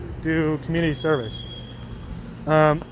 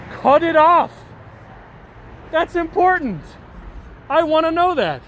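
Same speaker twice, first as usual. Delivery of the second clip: high, sarcastic faux-earnest voice; mock serious; 11 semitones higher than usual. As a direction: play it up like it's vital lore. Mock-serious aside about the plot.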